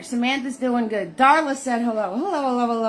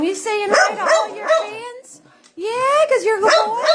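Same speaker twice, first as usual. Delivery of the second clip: high dog-talk voice; 9 semitones higher than usual. Real, not acted. Owner cooing to her excited dog Butters, high baby talk.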